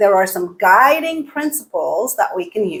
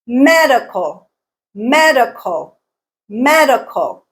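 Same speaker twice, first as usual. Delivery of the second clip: medical drawn out, ME raised and strong; first-syllable stress; 2 semitones higher than usual. Shown as a demonstration. Teacher showing learners word stress, medical stressed on ME. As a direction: punch ME in medical, let the rest fall. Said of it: "is the stressed syllable".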